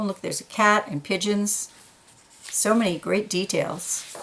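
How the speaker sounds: ordinary delivery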